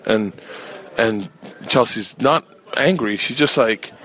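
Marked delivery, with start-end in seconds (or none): none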